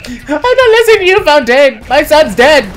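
high, loud mock wail; shrieked, hammed-up grief; distraught. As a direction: wail over your lost game son. Hammed-up grief over game companion.

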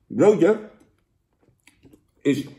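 talking with mouth full; speaking between bites; chewing. Real, not acted. Seen 0: Bites burger at -2.0, then talks while chewing with mouth partly full.

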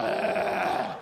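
low, guttural slurping growl; gobbling noise. Acted. Comic wolfing down coleslaw, gobbling noise. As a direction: growl as if shovelling food in.

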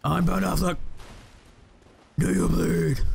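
gruff mock Batman growl; hammed-up Batman. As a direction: ham up the caped hero. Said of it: "Batman fights Longfinger Kirk".

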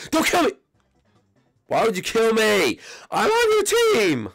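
a hammed-up wail at a teammate; outraged shriek. Shot by his own teammate, shrieking high and loud.

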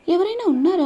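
high calling out into an empty house; loud, high cry for anyone there; frightened shout. A narrator's reading. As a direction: call out, scared and lost. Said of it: "అని అరుస్తూ ఉంది".

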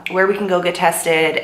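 usual speaking voice

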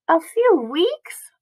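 high, slow, disbelieving question; dismayed. A narrator's reading. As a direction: echo it back in dismay. Child dismayed at hospital stay.

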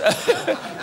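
short high-pitched laugh; high giggle. Real, not acted. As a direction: giggle at his own joke. Laughs at own joke. (kurzes hohes Kichern)

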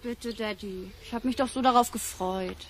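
soft, disappointed plea; quiet pleading. Acted. Daughter begs her father to allow the film stunt, quietly pleading. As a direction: beg him quietly, near tears.

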